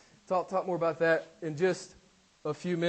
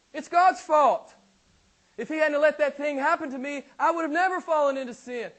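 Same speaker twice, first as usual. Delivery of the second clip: raised, whiny complaint blaming God; 8 semitones higher, 5 dB louder than usual. Retold or imitated. People blaming God for sin.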